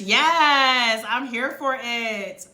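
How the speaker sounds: usual speaking voice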